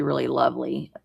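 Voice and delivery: normal talking voice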